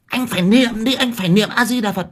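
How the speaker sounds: high, pushy, nagging scold; bossy woman ordering the dying man to chant; shrill scolding old woman. Retold or imitated. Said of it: "quát người sắp chết".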